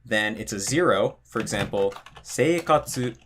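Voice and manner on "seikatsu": seikatsu said with flat pitch and no drop; flat pitch accent. Shown as a demonstration. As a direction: keep seikatsu level to the end. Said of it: "the whole word is flat, there is no drop".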